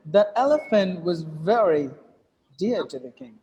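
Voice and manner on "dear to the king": normal talking voice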